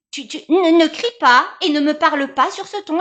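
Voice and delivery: mother scolding her son for shouting, loud and stern. Acted. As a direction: put him in his place firmly.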